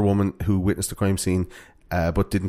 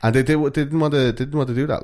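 put-on higher, stammering Finnish accent; 5 semitones higher than usual. Host continuing the diary entry, mock Finnish accent. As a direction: stumble through it as a Finn. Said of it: "like, a good Finnish accent".

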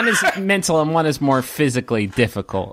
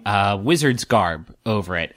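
ordinary talk, nothing marked

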